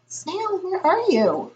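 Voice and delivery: high searching call. A narrator's reading. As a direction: call out for a missing friend. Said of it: "called Franklin".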